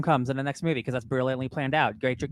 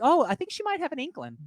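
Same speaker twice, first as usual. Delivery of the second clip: high musing; 11 semitones higher than usual. Retold or imitated. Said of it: "I was like".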